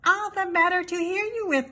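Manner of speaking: high put-on granny voice hiding the wolf; grandma voice. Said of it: "said the wolf in a grandma voice".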